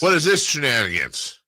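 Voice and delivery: high-pitched mock outrage; grumpy older person. Older man baffled by the new decade, mock indignant. Acting older self griping at change.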